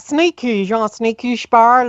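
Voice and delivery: hammed-up American twang, showing how good the brand sounds over there; American accent. Teasing the radio host, she says Snickers in mock American accent. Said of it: "an American accent".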